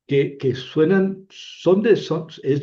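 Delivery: usual speaking voice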